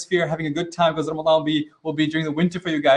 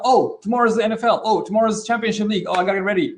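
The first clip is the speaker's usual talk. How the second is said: higher-pitched, startled "oh" outbursts; mock sudden realisation; 4 semitones higher, faster than usual. Teacher mimicking an unprepared athlete waking up, mock alarm. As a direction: act as if only just remembering the big day.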